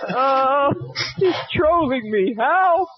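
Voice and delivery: high, loud fake plea for help; hammed-up cry for help; mock distress.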